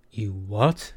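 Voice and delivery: incredulous question, rising sharply at the end; exclaiming. A narrator's reading. Aki stunned by Kiva's cage gym news, exclaiming. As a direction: swing up on the last word in disbelief. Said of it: "Aki exclaimed".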